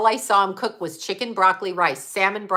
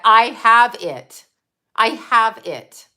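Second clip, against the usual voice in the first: louder, slow, each word hammered; defiant retold shout; 7 dB louder, much slower than usual. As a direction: insist it slowly and hard. Angry retelling of his death.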